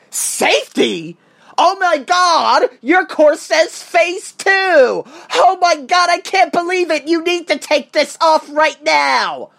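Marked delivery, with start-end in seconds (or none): 1.6-9.5 higher, slow hammed-up alarm; mock panic. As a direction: overplay the shocked safety team. Mocking the safety team's panic.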